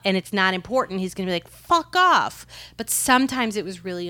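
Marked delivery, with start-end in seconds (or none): 1.5-2.4 high-pitched mock outburst from a woken boss; acted angry brush-off. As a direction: snap it out as an irritated exec. Woken executive's angry reply, acted.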